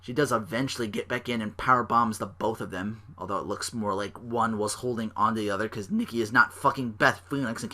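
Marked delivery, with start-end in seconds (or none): none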